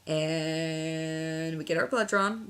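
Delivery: ordinary talk, nothing marked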